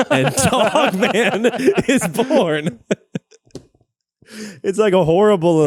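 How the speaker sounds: high, loud, helpless laughing delivery; squeezed out high between laughs; laughing through words. Real, not acted. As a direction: crack up while getting the words out. Cracking up at movie plot.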